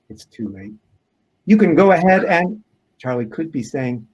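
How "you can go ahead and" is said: higher, louder unfinished plea; unclear first attempt. A narrator's reading. Charlie to the officers, unfinished, raised voice. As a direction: raise pitch and volume, break off at AND. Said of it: "Then he says clearly".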